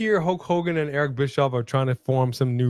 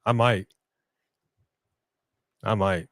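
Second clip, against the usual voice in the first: mock sad; 5 semitones lower than usual. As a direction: play up being sad. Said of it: "gonna cry in the car".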